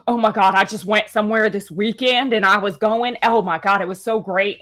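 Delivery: imitated Southern accent of a young woman; deep Southern accent. Hairdresser chatting about the weekend, imitated deep Southern accent. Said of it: "have that deep Southern accent".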